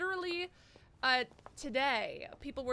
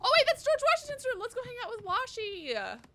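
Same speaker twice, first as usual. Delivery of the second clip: high, loud burst of excitement; excited squeal; 11 semitones higher, 8 dB louder than usual. Real, not acted. Player discovering Washington's room, excited squeal. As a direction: exclaim high and loud, easing down.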